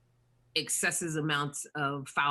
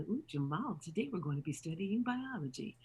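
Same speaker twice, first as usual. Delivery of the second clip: school teacher; 2 semitones higher, 5 dB quieter than usual. Retold or imitated. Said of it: "I played Jamal's school teacher".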